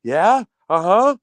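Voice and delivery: mock a woman on the phone; lightly mocking phone chatter; imitating phone chatter. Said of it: "starts going like".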